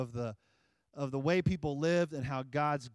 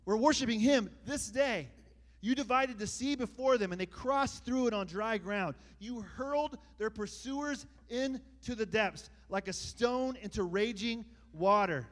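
rising, louder preacher's read; preaching; 8 semitones higher, 4 dB louder than usual. Acted. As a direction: build the scripture toward a climax. Preaching builds.

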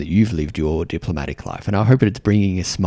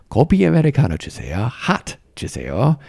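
put-on quick, light American accent, a touch higher; 6 semitones higher, much faster than usual. Ordering coffee at Starbucks, mock American accent. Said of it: "make fun of an American accent".

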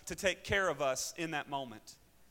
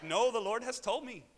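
put-on loud, higher-pitched Kenyan accent; 6 semitones higher, 6 dB louder than usual. Student assures doubting pastor about marriage, Kenyan accent. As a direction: insist firmly in an imitated Kenyan accent. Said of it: "That's my Kenyan accent".

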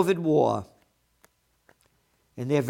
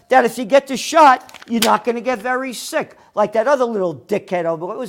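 louder, higher furious tirade; angry rant; 6 semitones higher, 8 dB louder than usual. Real, not acted. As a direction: lay into the networks, building anger. Ranting at networks about vaccines.

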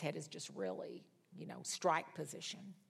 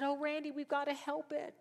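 high, pitying appeal; retold high-voiced plea; worried pleading; 9 semitones higher than usual. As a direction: plead for the poor kitten, voice lifted. Pleads with husband for kitten.

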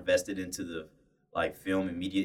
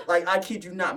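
usual speaking voice

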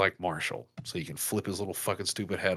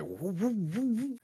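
vocal woo woo of a revving engine, leaping higher; engine revving noise; 11 semitones higher, slower than usual. Retold or imitated. Host mimicking a Lamborghini revving in a porn clip. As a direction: mimic a sports car revving. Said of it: "She revved the engine of the car".

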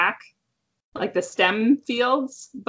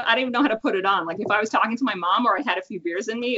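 normal talking voice

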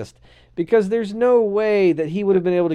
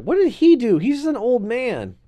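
mock protest; 8 semitones higher, 5 dB louder, slower than usual. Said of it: "I'm like".

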